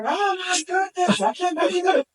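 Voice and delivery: high-pitched shout of disbelief; reliving an astonished yell; retold yelling. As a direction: re-enact crying out, voice leaping way up. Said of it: "I started yelling some more".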